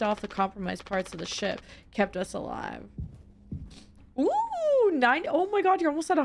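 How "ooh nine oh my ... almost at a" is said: high-pitched delight; thrilled high voice; excited squeal. Real, not acted. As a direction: squeal at the rising number. Excited at counter.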